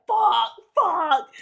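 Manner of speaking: high, loud frantic swearing; panicked cursing. Retold or imitated. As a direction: curse in sudden panic. Panicked cursing mid-fight.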